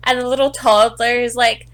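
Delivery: ordinary delivery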